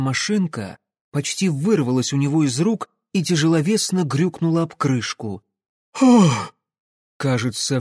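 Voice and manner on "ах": loud, high startled gasp; sudden breathy gasp of dread; sharp gasp. A narrator's reading. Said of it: "все выдохнули в один миг". (испуганный громкий вздох)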